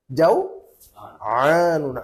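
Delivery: slow Arabic pronunciation of जवान with ain. Shown as a demonstration. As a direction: stretch जवान and squeeze the ain from the throat. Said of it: "आयन के साथ में".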